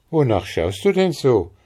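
höher und lauter, neugierig; Maren, fragend. Acted. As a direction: lebhafte Mädchenfrage. Maren asks Andres. (Maren fragt Andres höher und lauter)

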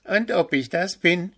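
high, cocky shout back. A narrator's reading. Owl Willi shouts back at Puh, high cocky call. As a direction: fire it straight back, proud. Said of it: "schrie Willi kauz zurück".

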